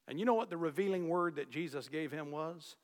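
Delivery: ordinary talk, nothing marked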